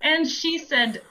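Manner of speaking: normal talking voice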